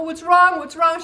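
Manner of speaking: urgent asking. Retold or imitated. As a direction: press the child for an answer. Child still inconsolable.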